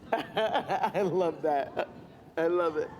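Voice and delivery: slow laugh-through words; amused laughing reply. Real, not acted. Laughing at the joke.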